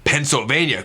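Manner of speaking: usual speaking voice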